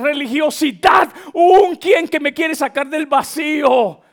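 loud, high sermon cry; impassioned preaching. Real, not acted. Preacher urging church from things to a person, impassioned. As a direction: drive each phrase home at full voice.

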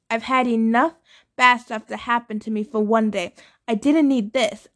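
ordinary delivery

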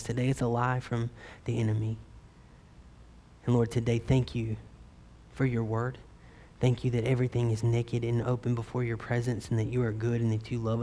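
low-key, soft prayer voice. Real, not acted. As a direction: pray softly and reverently to God. Leads the congregation in prayer.

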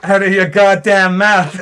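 loud, high, drawn-out mock yell; playful over-the-top telling-off; mock outrage. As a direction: shout it in hammed-up indignation. Mock outrage, finishing a parody quote.

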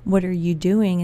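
disapproving question. Retold or imitated. Imagined critic questioning her, disapproving. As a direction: question it as if it isn't allowed. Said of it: "You can't do that".

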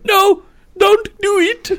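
slow, very high falsetto begging; hammed-up helpless pleading, drawn out; imagined victim's plea. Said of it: "you could just imagine him going".

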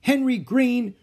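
high, loud angry teacher; teacher shouting a pupil's name. Acted. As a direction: call him out sharply over the noise. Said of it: "shouted for silence".

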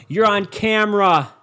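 loud, high, drawn-out mock yell; imitating a stranger yelling at a car; imitated yell. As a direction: stretch it out like a street shouter. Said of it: "he yelled at me in the car".

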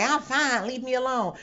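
offended. Acted. Stressed woman to a caring husband or friend, mock offended. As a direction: act out brushing someone off in a huff. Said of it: "Don't get offended and say".

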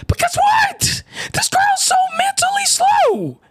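high, shouted mockery; exasperated shout. Real, not acted. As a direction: yell it up high in disbelief. Mocking the girl.

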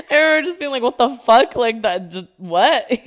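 loud, high, stunned outburst; loud disbelief. Real, not acted. Telling listeners about the coach's plan, loud and stunned. Retelling her shock.

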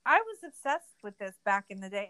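ordinary talk

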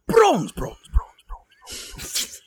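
loud high bronze fading into whispered repeats; magical echo. Acted. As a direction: let the word echo away into nothing. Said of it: "prestidigitation to echo my voice".

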